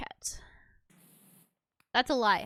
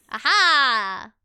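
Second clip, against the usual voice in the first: triumphant cry; 3 semitones higher, 13 dB louder than usual. Real, not acted. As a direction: call out your discovery at full volume. Finds the dirt, cries out.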